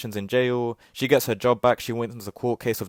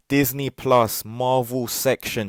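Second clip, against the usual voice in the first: slow, louder, word-by-word repeat; 5 dB louder, much slower than usual. Shown as a demonstration. Repeating the absurd menu scene to viewers, slow and emphatic. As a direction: hammer each word out slowly for disbelief. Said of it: "I repeat".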